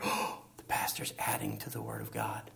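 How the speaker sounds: gasping, hushed whisper of a worried churchgoer; shocked whispered accusation; mock fearful critic. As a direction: whisper it like a scandalized listener. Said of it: "that's the fear".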